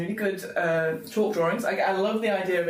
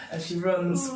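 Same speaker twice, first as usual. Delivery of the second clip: usual speaking voice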